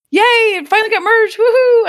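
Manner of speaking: high, loud sing-song yay; squealing mock celebration; mock cheer. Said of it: "the comment was like".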